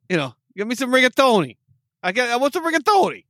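badly faked New York accent. Co-host proving his New York skills, badly faked accent. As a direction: order pasta in a clumsy New York accent. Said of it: "Even that, you're bad".

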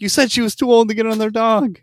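high-pitched retold protest; exasperated retort. Guest correcting confused man about his sister, exasperated retort. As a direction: argue back in comic frustration. Arguing back in retold misunderstanding.

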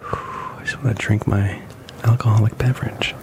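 breathy half-whisper; hoarse whisper. Real, not acted. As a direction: whisper it through burning lips. Mouth burning from hot chip.

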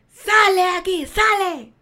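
loud, high retold shout; angry yell. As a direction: bellow the intruder out of the house. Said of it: "Nunca volví al grito". (grito fuerte y agudo para echarlo)

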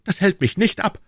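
put-on high, impetuous young hero; defiant young D'Artagnan. D'Artagnan defies the warning. (trotziger junger Mann, hohe Stimme)

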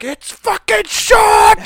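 slow, high furious chef yell; Gordon Ramsay rage. Retold or imitated. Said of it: "kind of like a Gordon Ramsay thing".

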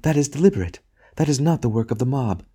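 high, pained woman's voice; lightly voiced wife crying out in distress. A narrator's reading. Wife protesting the volors to her husband, crying out. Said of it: "she cried".

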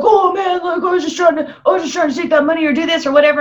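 high, fast, whining excuses; mock the guy babbling excuses; whiny character. Retelling a movie character's excuses, high whiny mock.

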